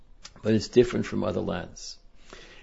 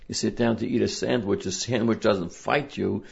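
ordinary talk, nothing marked

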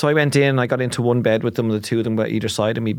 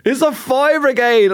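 urgent plea; 11 semitones higher, 4 dB louder, slower than usual. Retold or imitated. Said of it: "they're going".

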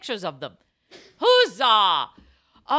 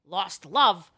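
ordinary talk, nothing marked

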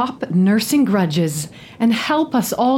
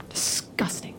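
disgusted whisper; 12 dB quieter than usual. Acted. Elena pushes him away.

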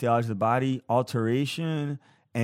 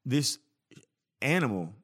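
ordinary talk, nothing marked

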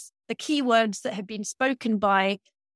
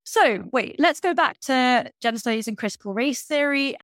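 usual speaking voice

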